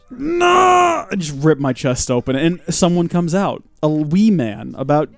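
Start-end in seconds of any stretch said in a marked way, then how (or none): 0.0-1.1 hammed-up startled no; mock horror. Streamer reacting to a fake scare, hammed-up cry. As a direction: gasp then high cry of mock horror. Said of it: "and then I react".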